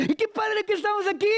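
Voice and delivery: high, overexcited blurt; Peter blurting. Acted. Peter panics for something to say, giddy high blurt. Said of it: "Pedro está viendo todo".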